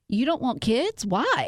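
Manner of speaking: pointed, judging inflection, mimicking the hosts; mock incredulous interrogation; judgmental questioning. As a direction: imitate disbelieving judgment, pitch rising on the question. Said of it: "tell it in my inflection in my voice. There's judgment there".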